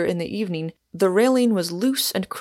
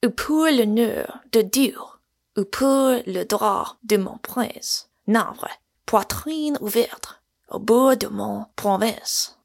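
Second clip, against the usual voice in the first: rolling French verse declamation; measured, grandly recited French poetry; voice like a drum; 3 semitones higher than usual. A narrator's reading. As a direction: roll out the noble lines with ceremony. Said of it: "in a voice like a drum".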